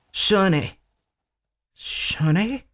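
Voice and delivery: softly calling her name, worried; gentle, hoping she wakes; tender calling. Acted. Husband softly calling his dying wife's name.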